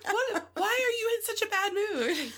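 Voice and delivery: high, mocking child whine; whiny kid. Mother imitating her daughters complaining about her mood, high mock whine.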